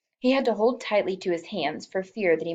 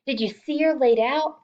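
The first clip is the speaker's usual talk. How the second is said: excited; 6 semitones higher, 4 dB louder than usual. A narrator's reading. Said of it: "she asked excitedly".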